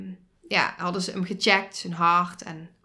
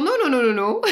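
panicked protest; 3 semitones higher, 5 dB louder, faster than usual. Retold or imitated. Mother protesting to the German nurse, loud half-laughing no. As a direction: push back in a retold panic.

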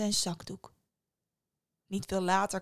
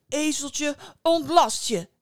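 high call, louder at the end; called command; 7 semitones higher, 8 dB louder, slower than usual. A narrator's reading. Antoine commanding his donkey, calling out. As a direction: call out the magic order. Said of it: "riep Antoine uit".

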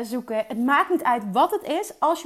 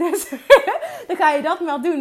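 laughing; 4 semitones higher, 7 dB louder than usual. Real, not acted. Laughing at coach's advice.

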